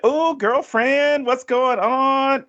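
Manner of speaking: high, slow, sugary mock girlfriend greeting; mock fake-friendly mom. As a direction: parody an over-eager mom calling her friend girlfriend. Mimicking mom in gym hallway.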